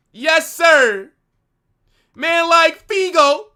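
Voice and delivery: loud, high cheer; hype shout. Real, not acted. Streamer cheering for Figo, loud and high. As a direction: yell it like a fan in the stands.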